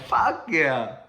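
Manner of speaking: triumphant cheer. Retold or imitated. Kid celebrating after bus driver's praise, triumphant. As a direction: let out a pumped-up victory shout.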